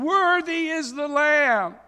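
high, loud and slow, proclaiming to heaven; loud proclamation. Retold or imitated. Angels around the throne praising the Lamb in a loud voice. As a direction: cry it out slowly like a crowd of angels. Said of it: "in a loud voice they were saying".

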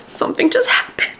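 choked-up whisper right after a sad chapter; tearful whisper. Real, not acted. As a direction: whisper it through tears, words barely voiced. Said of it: "I keep crying".

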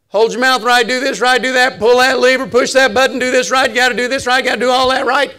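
loud, high rattled-off list; preacher parodying nagging rule-keepers; mock rule-reciting. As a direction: pile up the demands in one insistent run.

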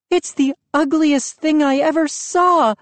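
high dismayed outcry; dismayed cry. A narrator's reading. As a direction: recoil at the ugly statue. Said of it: "Mom cried".

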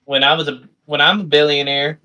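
ordinary delivery

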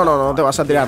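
normal talking voice

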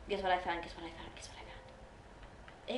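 low repeated question sinking into a whisper; fading to whisper. Real, not acted. Player still teasing the find, fading to whisper. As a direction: let the repeats die away to a whisper.

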